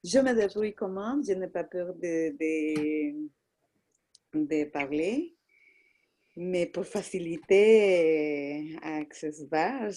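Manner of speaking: real Latin American accent in French; French with a Spanish-speaking accent; foreign accent in french. Real, not acted. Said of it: "ton accent est merveilleux".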